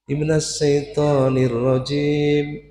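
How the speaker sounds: slow, high, swooning chant; sighing, sultry recitation. Shown as a demonstration. Teacher showing women what to avoid, sighing recitation. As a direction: sigh through it, stretching every syllable. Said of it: "bisa mendesah".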